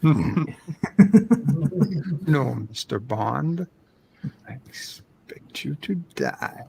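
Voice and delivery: menacing villain fading to a whisper; sinister Bond villain, dropping to a hushed threat; supervillain voice. Pretend. Echoing the villain line back.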